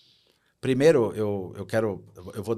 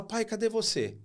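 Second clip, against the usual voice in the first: put-on scared child; 12 semitones higher than usual. Criança assustada sem o pai.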